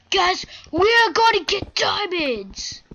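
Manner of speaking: high, loud, drawn-out excitement; excited announcement. Real, not acted. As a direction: crow it slowly to the whole crew. Spots diamonds in the game.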